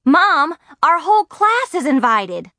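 high, loud burst of news; excited. A narrator's reading. Margaret races back to the studio, excited shout. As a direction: announce it breathlessly eager to Mom.

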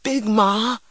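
put-on high, pleading child; balking protest. A narrator's reading. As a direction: refuse with a rising appeal to your grandmother. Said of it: "I balked".

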